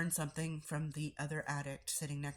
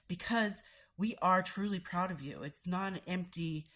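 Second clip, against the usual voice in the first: usual speaking voice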